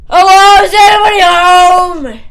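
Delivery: loud, high call into an empty house; high-pitched hammed-up holler. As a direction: yell out as if checking whether anyone's home.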